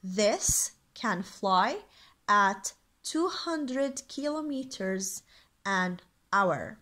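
very slow, word-group by word-group reading; measured, carefully separated English dictation; slow teacher's read-out. Shown as a demonstration. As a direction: read it out for learners with careful pauses. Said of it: "Again، مرة تانية".

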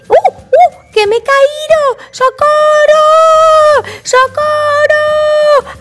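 put-on high, drawn-out toddler wail; panicked cry for help. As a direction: wail for rescue, stretching each cry. George falls in, calls for help.

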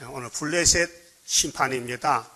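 ordinary talk

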